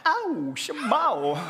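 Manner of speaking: high, drawn-out pop-star whoop; hammed-up falsetto star cry, stretched slow.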